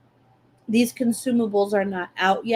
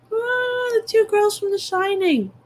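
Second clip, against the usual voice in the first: creepy twin voice; 12 semitones higher than usual. Retold or imitated. Imitating creepy Shining twins.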